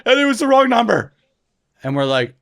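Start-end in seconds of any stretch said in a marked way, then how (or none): 0.1-1.1 put-on high, loud panicked wail as a little boy; hammed-up child in horror at his mistake; horrified child. Four-year-old confessing a wrong number to his brothers, horrified. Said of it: "the look of horror on his face".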